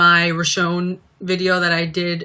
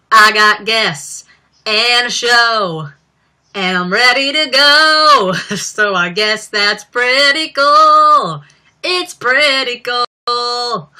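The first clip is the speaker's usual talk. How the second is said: slow, loud, higher mock teen star voice; 5 semitones higher, 6 dB louder, much slower than usual. Vlogger imitating the [name] sketch host greeting viewers, slow and loud. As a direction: draw it out like a ditzy talk-show host.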